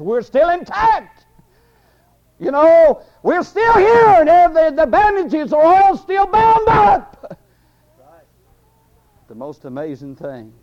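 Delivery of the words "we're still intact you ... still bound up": high, drawn-out shouted answer; grave clothes protesting. Acted. Grave clothes insisting to the devil they are still intact, shouted protest. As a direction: holler back slowly and very high. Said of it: "he cries out to the grave clothes".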